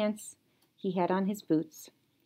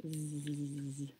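quiet buzzing snore; soft snoring zzz; 5 semitones lower, 14 dB quieter than usual. Acted. Froggy falling asleep, soft snore. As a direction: snore it gently.

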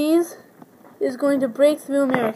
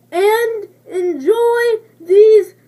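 mock sobbing; 6 semitones higher, 3 dB louder, much slower than usual. Ad, crying.

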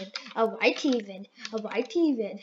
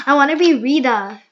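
shouting the wish, louder; loud whine; 11 dB louder than usual. Real, not acted. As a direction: belt it out like a demand. Kid whines repeating wish.

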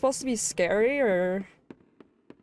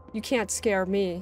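ordinary talk, nothing marked

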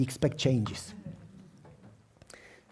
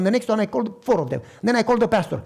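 normal talking voice

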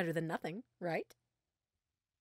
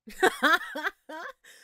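a high, loud mocking laugh; 12 semitones higher, 9 dB louder than usual. Scientist to the caught thief, high mocking laugh. Captor mocks the captured thief.

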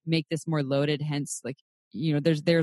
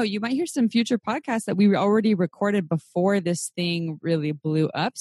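ordinary delivery

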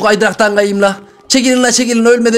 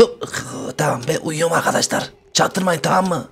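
much softer, hushed and low; 6 semitones lower, 9 dB quieter than usual. Acted. The doll whispers to viewers while faking sleep, much softer. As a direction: hush it so nobody hears you're awake.

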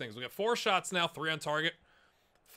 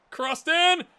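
high, loud burst; sudden high goal shout; excited call; 13 semitones higher, 10 dB louder than usual. Real, not acted. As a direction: yell it as the cross comes in. Calling out the goal.

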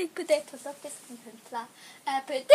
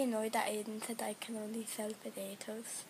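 whispered annoyed confession; 10 semitones lower, 11 dB quieter than usual. Real, not acted. Whispering to viewers that she's annoyed at Aiden. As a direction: whisper the complaint.

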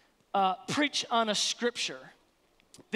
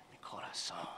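breathy whisper of "Mi corazón"; whispered endearment; 15 dB quieter than usual. Shown as a demonstration. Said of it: "It just sounds nice".

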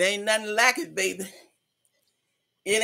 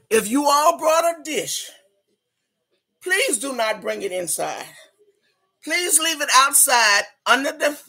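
ordinary talk, nothing marked

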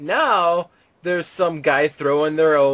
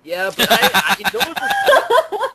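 stretched out, breaking into laughter; laughing; 10 semitones higher, 3 dB louder than usual. Real, not acted. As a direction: laugh through the words. Cracking up while introducing story.

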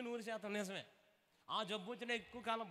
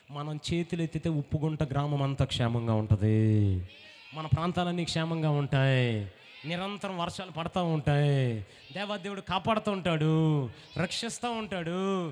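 loud, lower, driving sermon voice; fervent preaching; 5 semitones lower, 14 dB louder than usual. Real, not acted. Pastor rousing the congregation, loud full-force preaching. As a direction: preach it to the rafters.

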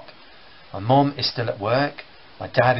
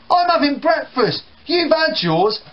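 put-on sulky kid; 12 semitones higher, 4 dB louder than usual. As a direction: answer back like a kid. Brothers quarrel in acted scene.

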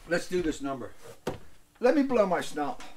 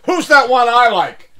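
loud, higher mock yell; yelling Mike; 7 semitones higher, 13 dB louder than usual. Mike yelling at the storyteller, loud mock anger. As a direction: bark it back across the bar. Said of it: "Mike, I said".

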